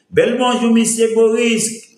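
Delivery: called-out greeting. Real, not acted. Hailing viewer Boris with a high, loud called-out hello.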